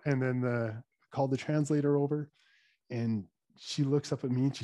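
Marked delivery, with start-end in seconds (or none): none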